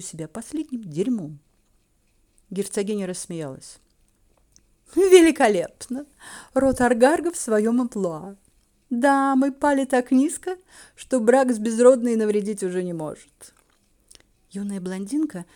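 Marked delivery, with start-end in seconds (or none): 4.9-13.5 loud, delighted scorn; gleeful mockery. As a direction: relish the family's disgrace. Duchess gleefully mocks the family.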